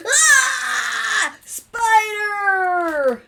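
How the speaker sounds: high, loud mock shriek with drawn-out word; storybook scream. Family spotting a dancing spider, hammed-up scream. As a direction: scream in pretend terror for children.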